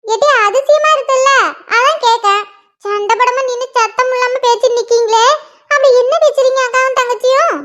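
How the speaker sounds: lightly voiced nagging mother; mother scolding. Mother finds her daughters sitting idle, nagging. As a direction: grumble at the lazy girls like their mum.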